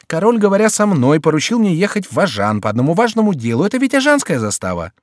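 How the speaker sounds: high, emphatic Chicot; urgent, high-pitched insistence; self-important Chicot. Acted.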